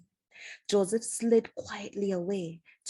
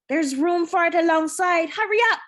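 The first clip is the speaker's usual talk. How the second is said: much higher and louder, urging haste; impatient cry; 9 semitones higher, 8 dB louder than usual. A narrator's reading. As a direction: shout orders while loading crates. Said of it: "cried the same voice".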